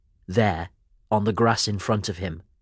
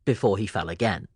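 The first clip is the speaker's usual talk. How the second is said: ordinary delivery, nothing marked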